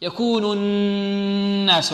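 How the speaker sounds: يكون الناس very slow with the noon hummed long; held nasal ghunnah. Shown as a demonstration. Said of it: "غنة هنا في النون".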